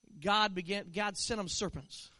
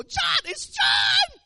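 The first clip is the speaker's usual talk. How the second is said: high, drawn-out overjoyed cry of a name; joyful shout; 12 dB louder, much slower than usual. Pretend. Believers in heaven spotting the preacher, shouting with joy. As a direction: call out like spotting a long-lost friend. Saints greeting him in heaven.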